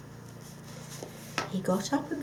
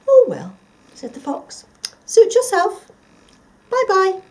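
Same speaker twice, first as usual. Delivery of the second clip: much higher, loud breezy fox; airy sly fox; 12 semitones higher, 14 dB louder than usual. Acted. The fox breezily leaving the dog, much higher. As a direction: shrug him off lightly.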